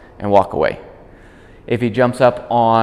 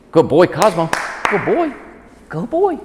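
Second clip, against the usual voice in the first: excited praise; 8 semitones higher than usual. Real, not acted. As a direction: praise the dog with rising excitement.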